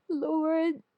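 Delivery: high, far voice for grieving Martha; Martha voiced high. A narrator's reading. Martha pleading with Jesus after her brother's death, high voice. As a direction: lift the voice into Martha.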